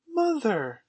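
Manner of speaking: high, loud call falling at the end; child calls urgently to mother, high; crying out. A narrator's reading. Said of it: "Wendy cried".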